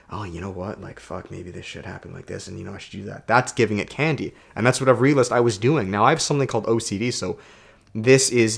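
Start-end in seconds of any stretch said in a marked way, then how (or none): 0.1-3.3 very soft, talking to oneself; inner-thought murmur. Acted. Monkey mind getting fed attention, very quiet musing.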